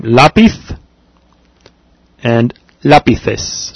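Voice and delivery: Lápiz and lápices with th for z and c; tongue between teeth on the z of Lápiz; Castilian lisp. Shown as a demonstration. Teacher repeating the Spain version, th lisp. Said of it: "they lisp it".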